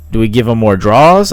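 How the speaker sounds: normal talking voice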